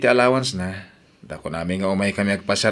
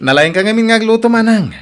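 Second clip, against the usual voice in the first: laughing tease; 11 semitones higher, 8 dB louder than usual. Acted. Sibling teasing with a laugh.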